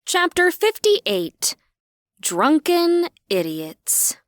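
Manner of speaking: slow, high, loud title read; chapter title announcement. A narrator's reading. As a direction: announce the chapter heading slowly. Reads chapter heading.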